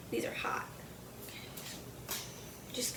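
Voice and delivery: whisper. Real, not acted. Handling fresh cupcakes.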